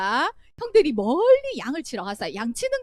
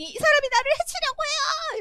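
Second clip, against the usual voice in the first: a hint of a wife crying out in false alarm; wife's accusing cry; 13 semitones higher than usual. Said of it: "소리 지르는 바람에". (부인이 요셉을 모함하며 높은 목소리로 외치는 흉내)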